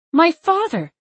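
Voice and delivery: high, loud, upset snap; voice rising. Acted. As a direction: raise the voice sharply. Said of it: "my voice rising".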